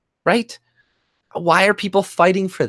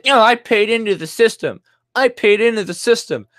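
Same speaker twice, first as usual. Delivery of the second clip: higher, louder mock of an old-timer protesting; mock boomer; 4 semitones higher, 3 dB louder than usual. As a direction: parrot the boomer's complaint, higher. Mocking boomers who say they paid in.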